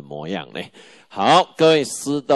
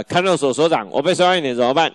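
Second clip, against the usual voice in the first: fast, higher and louder plea to the warden, a touch hammed-up; a detainee pleading with the warden, higher, fast and louder; anxious detainee; 6 semitones higher, 5 dB louder, faster than usual. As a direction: rush the question at the warden like a panicked inmate.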